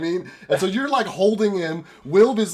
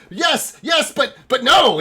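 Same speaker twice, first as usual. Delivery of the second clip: high, loud agitated shout; stammered mad cry; insane elf; 11 semitones higher, 9 dB louder than usual. Acted. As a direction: swing from agreement to frantic denial. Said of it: "fucking insane elf".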